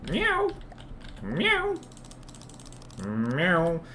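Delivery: squeaky little meow; high cat meow. Pretend. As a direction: mew like a tiny kitten. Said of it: "Not even a cutesy meow".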